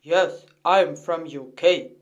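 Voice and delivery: ordinary talk, nothing marked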